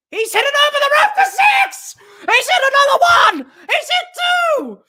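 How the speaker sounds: high, loud screaming commentary; ecstatic six call. Real, not acted. Commentator to viewers as another six lands, ecstatic shout. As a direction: shout it in rising disbelief.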